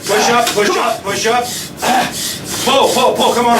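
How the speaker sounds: straining. Real, not acted. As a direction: shout through the effort. Urging himself through the burst.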